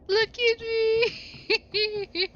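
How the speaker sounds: high, slow, stretched dinosaur cry. Pretend. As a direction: wail it out like a proud little beast. Proud new dinosaur shows itself off.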